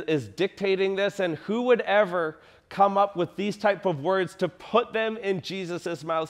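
normal talking voice

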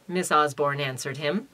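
normal talking voice

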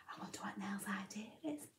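fast, soft whispered wonder; whisper aside. Acted. Reader asking the children, fast whisper. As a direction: lean in and whisper the question. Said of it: "reader whispers aside to listener".

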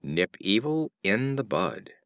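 ordinary delivery, nothing marked

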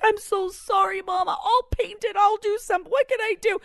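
put-on high, pleading child apology; mock a tearful kid begging forgiveness; imitating crying son. Mom mimicking son apologizing for spraying mace, tearful. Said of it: "he starts crying 'cause he feels terrible".

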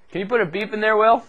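asking the tech for a beep, calling out. Real, not acted. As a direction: call over to the tech booth. Calling to the tech across the room.

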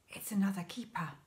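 hushed unvoiced whisper. A narrator's reading. Father warning Danny as a keeper approaches, whispered. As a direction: whisper it so the keeper won't hear. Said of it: "he whispered".

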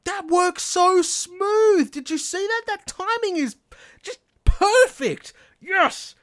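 high, loud, slow delighted shouts of joy; real excitement. Real, not acted. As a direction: cheer like the machine just worked perfectly. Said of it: "Sorry, I'm getting so excited".

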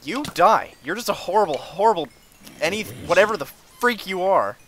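high, loud insults at an enemy; angry outburst. Real, not acted. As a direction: yell at the monster attacking you. Raging at an enemy.

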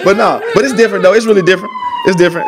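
high, laughing through the words; giggling so hard the words squeak. Real, not acted. Asked to repeat his slang, laughing high. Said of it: "laughing high defence of "worse".

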